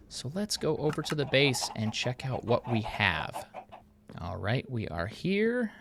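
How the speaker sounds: normal talking voice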